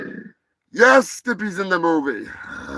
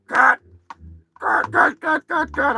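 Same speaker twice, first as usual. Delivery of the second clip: director calling cut, raised and higher; rapid repeated cut calls; 7 semitones higher than usual. Acted. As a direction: yell it across the set.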